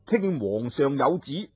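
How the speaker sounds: plain reading voice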